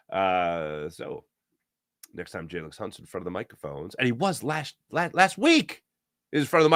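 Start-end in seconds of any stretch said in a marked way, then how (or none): none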